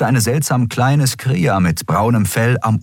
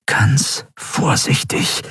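very slow, whispered self-warning; extra-careful caution; 3 semitones higher, much slower than usual. A narrator's reading. Andriel easing power into the weapon, whispered caution. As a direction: whisper it, word by word.

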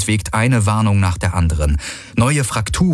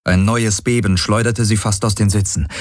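plain reading voice

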